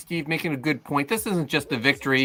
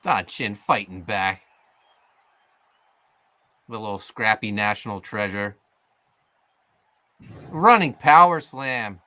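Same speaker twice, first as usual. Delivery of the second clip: wrestling commentator; 4 dB louder than usual. Acted. Live wrestling play-by-play.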